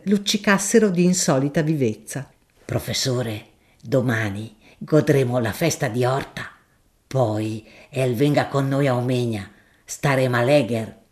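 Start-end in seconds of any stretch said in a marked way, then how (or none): 2.6-11.0 a deferential, earthy shopkeeper making his offer; grocer Gaudenzio. Acted. Gaudenzio invites him.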